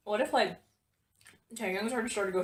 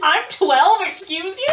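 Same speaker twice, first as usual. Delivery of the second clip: loud, higher mock indignation; indignant kid; 8 semitones higher, 13 dB louder, slower than usual. Imitating a kid snapping at gamers, loud mock indignation. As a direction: huff like an offended twelve-year-old.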